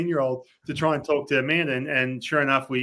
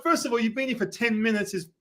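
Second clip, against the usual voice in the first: mock scolding; 6 semitones higher than usual. Retold scolding of the kid.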